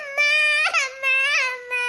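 slow sobbing wail for mother; childlike tearful bawl. Acted. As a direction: keep crying out, dragging the word. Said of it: "இவள கத்து கத்துறாள".

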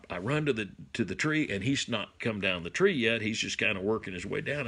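ordinary talk

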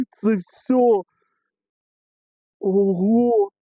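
high, stretched cry of amazement; real excited cries. Real, not acted. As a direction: exclaim in overjoyed disbelief. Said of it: "Покричав тільки що".

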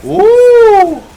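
high, loud excited whoop; excited shout. Real, not acted. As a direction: shout in delight at the play. Excited at a play. (신나서 지르는 소리)

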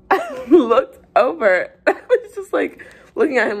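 high, laughing through the words. Real, not acted. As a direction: crack up while telling it. Cracks up telling the story.

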